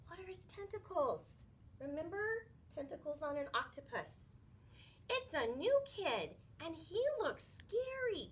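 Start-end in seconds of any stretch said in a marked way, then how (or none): 5.1-8.3 high, alarmed warning; Joey spots a huge shadow; frightened cry. Acted. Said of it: "cried Joey Mackerel".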